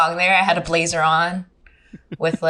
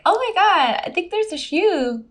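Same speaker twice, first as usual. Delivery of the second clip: high-pitched mock alarm; high, amused disbelief; retold alarmed discovery; 9 semitones higher than usual. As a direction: act out spotting something odd, voice way up. Retelling spotting shoe in tire.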